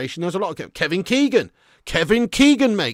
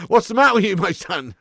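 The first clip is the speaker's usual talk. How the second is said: high, loud words bursting through laughter; laughing disbelief; 5 semitones higher, 6 dB louder than usual. Real, not acted. Streamer laughing at a gross chat comment, loud and high.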